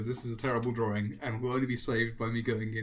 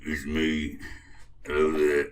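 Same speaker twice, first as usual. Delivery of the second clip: low, loud goofy villain voice; deep character voice; 10 semitones lower, 3 dB louder, slower than usual. Acted. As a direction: drop way down and boom it out. Voicing the new character Gotmail.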